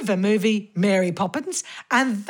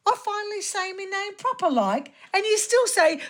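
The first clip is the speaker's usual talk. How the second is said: higher, quicker Cockney grumble; mock indignant; 7 semitones higher, faster than usual. [name] protesting to Arthur about his name, mock indignant. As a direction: complain in comic exasperation.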